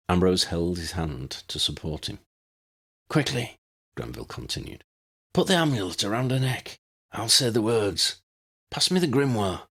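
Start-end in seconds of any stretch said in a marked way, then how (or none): 3.1-9.7 higher, urgent but weak; lightly urgent dying man. A narrator's reading. Granville urges them to start the ritual, urgent. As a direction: hurry them along, frail.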